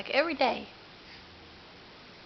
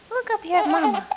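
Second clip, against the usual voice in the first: higher, louder call with up stressed; 6 semitones higher, 6 dB louder than usual. Real, not acted. Mother calls baby Andrew again, higher and louder baby talk. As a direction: lift it and punch up to get his eyes.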